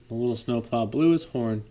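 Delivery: ordinary delivery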